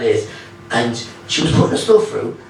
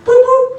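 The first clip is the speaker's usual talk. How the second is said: very high, louder beeping noise; watch scanner boops; 7 dB louder than usual. Retold or imitated. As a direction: chirp like a scanner. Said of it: "he went".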